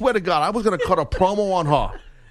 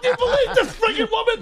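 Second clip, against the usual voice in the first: high laughing disbelief. Retold or imitated. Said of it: "I'm like".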